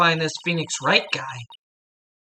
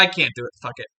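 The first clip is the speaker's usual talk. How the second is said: ordinary talk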